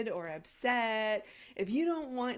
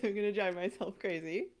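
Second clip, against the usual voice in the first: ordinary talk, nothing marked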